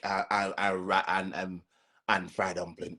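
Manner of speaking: ordinary talk, nothing marked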